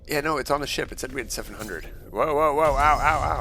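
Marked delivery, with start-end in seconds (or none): 2.1-3.4 loud pained yelps, higher; brain freeze. Real, not acted. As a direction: cry out at a sudden head pain. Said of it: "ow, my brain".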